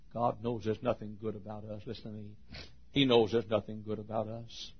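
normal talking voice

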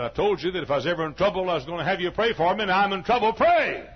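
fast, loud and high, building to the word "Pray"; urgent demand. Retold or imitated. Sick old lady in hospital demands the preacher pray, fast and loud. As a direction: press him hard to pray.

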